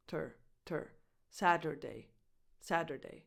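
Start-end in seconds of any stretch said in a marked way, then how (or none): 0.1-0.9 ter said short, soft and lower, a weak schwa; small quick ter. Shown as a demonstration. Teacher showing learners the unstressed middle syllable. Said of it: "it's faster and smaller".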